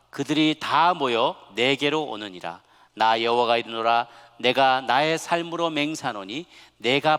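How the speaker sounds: slow, loud, measured recitation; drawn-out, declaimed Bible reading; scripture reading aloud. Acted. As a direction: lead a congregation in reading a verse. Leads congregation reading scripture aloud.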